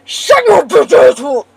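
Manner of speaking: full-throated angry shout; furious shout. Real, not acted. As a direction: yell it out, loud and raw. Raging at chat mid-stream.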